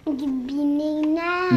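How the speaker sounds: slow, careful. Shown as a demonstration. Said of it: "Comment tu dis".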